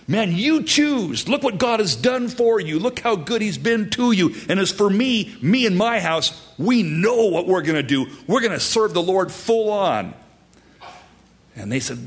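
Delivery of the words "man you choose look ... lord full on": high, rousing speech of resolve; impassioned, high-pitched challenge to choose; powerful proclamation. Acted. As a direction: proclaim it like a leader rallying his people. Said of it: "This is a powerful proclamation".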